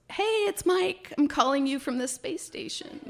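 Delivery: lightly voiced cheerful friend on the phone; imitating Mike. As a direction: pitch way up to play the caller. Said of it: "the voice on the other end goes".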